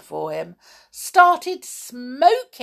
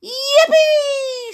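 high, loud gleeful whoop; joyful shout; 7 dB louder than usual. A narrator's reading. Old lady escaping into the sky, joyful shout. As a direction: cheer it out with glee. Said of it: "shouted the old lady with joy".

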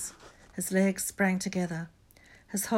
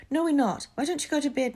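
put-on high, louder girl's voice; hasty denial; 9 semitones higher, 5 dB louder than usual. A narrator's reading. Hermione sending Neville to bed, high hasty denial. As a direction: brush him off in a raised pitch.

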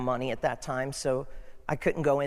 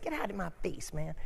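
quiet; 2 semitones higher, 7 dB quieter, faster than usual. Retold or imitated. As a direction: grumble it softly, half to yourself. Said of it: "somebody said".

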